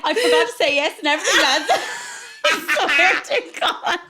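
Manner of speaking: fast, high, laughing. Real, not acted. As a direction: laugh it out in disbelief. Laughing through story ending.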